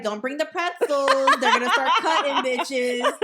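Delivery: loud, high warning through laughter; laughing mock warning. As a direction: shout the joke warning while cracking up. Retelling yearly joke amid laughter.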